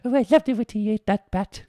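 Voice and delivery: high, loud granny voice; grandma voice. Acted. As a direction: crow it like a cheeky grandma. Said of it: "that's a good grandma right there".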